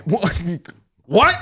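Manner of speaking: very high, loud double what; shocked disbelief. Retold or imitated. Husband stunned that his wife quits after one bite. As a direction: squeal it in outrage.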